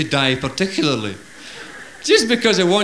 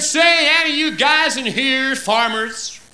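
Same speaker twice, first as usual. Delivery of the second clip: slow, higher American oilman voice; big American oilman asking the bar, slow and higher; American drawl; 10 semitones higher, slower than usual. Acted. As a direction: swagger in like a Texan.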